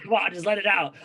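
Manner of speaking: high, loud coaxing voice, pushing him to let go; urging him on. Retold or imitated. Telling the emotional fighter on TV to stop holding back, loud and coaxing. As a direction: egg him on to just cry it out. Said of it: "I was like".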